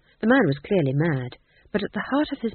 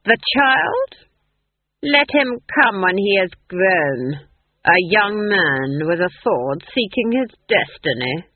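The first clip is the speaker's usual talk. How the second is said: sneering; 6 dB louder, slower than usual. Acted. The Duke sneering at Granny about the child, mocking. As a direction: scoff at the idea of a hero. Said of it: "the Duke sneered".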